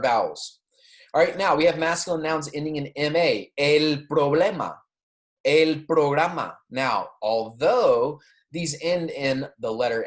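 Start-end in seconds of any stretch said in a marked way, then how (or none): 3.6-6.6 "El problema, el programa" said slowly and clearly; slow model pronunciation. Shown as a demonstration. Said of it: "Make sure you're repeating after me".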